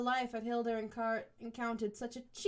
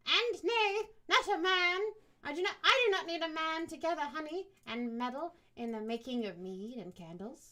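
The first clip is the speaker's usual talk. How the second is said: slurring bitter woman; 6 semitones higher, 4 dB louder than usual. Acted. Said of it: "spoke with a slight slur".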